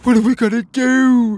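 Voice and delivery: very high, very loud panicked wail; frantic cry. Acted. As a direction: cry out in rising desperation. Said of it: "Petey cried".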